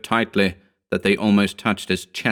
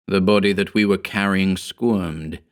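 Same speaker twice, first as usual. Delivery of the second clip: ordinary delivery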